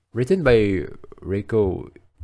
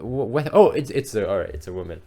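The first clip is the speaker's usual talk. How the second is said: usual speaking voice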